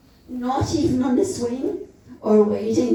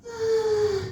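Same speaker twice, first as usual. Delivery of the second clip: little anguished cry; 11 semitones higher than usual. A narrator's reading. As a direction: let out a small high cry of longing. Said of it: "a little anguish cry".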